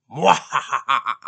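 loud villain mwahaha laugh; evil cackle. Acted. Evil laugh after intro.

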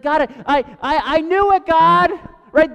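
high, loud tantrum voice, stuttering I, I; whiny toddler. Retold or imitated. Jonah as a toddler complaining to God, high loud whining tantrum. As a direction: play Jonah as a toddler not getting his way. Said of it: "A toddler not getting his way".

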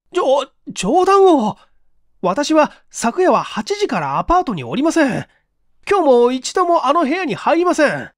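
high, loud, stammering protest; frantic young man denying everything; panicked denial. Acted.

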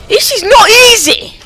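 very high, loud exasperated cry; frustrated outburst. Real, not acted. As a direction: yell it with NOT punched hard. Frustration escalates at the game.

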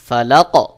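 "ফালাক" with the final ক pushed out; qalqalah bounce. Shown as a demonstration. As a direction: push the last ক of "ফালাক" with an echo. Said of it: "প্রতিধ্বনি করা". ("ফালাক" এর ক ধাক্কা দিয়ে)

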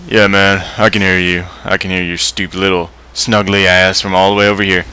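ordinary talk, nothing marked

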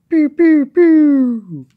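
loud, piercingly high pews; laser pew sounds. Pretend. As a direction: squeal out laser blasts, last one long and falling. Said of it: "like they do in the series".

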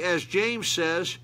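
ordinary delivery

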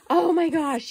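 Oh my gosh rising loud and high with awe. Real, not acted. Opening a gift, she bursts out in excitement.

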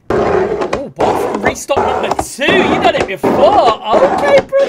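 loud, high hyped-up yell; excited outburst. Real, not acted. Reactor greets the restock video's start, excited shouting.

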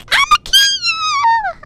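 extremely high, drawn-out mock scream; mad shriek, imitated. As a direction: screech it as a hammed-up furious player. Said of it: "so mad".

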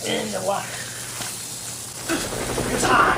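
strained voice, mouth on fire; after eating wasabi. Real, not acted. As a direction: croak for water, mouth burning. Still burning from wasabi.

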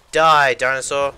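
loud, slow mock battle cry. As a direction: shout it as you attack in a game. Attacking a dinosaur in the game.

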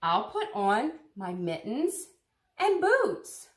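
bright storybook read-aloud; reading to children. Acted. Teacher reading Biscuit's Snowy Day to children, storytelling. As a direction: read it warmly to a circle of kids.

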